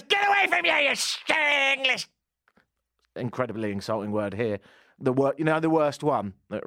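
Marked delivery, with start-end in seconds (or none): 0.0-2.1 put-on Irish accent. Homeless man chasing him off, screeching in an Irish accent. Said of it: "And he goes".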